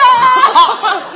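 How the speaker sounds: falling burst of real laughter. Real, not acted. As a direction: laugh out at your own joke. Comic laughs at own bit.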